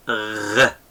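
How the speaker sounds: throaty rolled "gh" for "R"; repeated letter-sound demo; soft Gamal sound. Shown as a demonstration. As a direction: gargle the "R" briefly at the back of the throat. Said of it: "The sounds of this one is".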